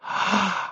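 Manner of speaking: realizing gasp. Acted. Rumpel's realization.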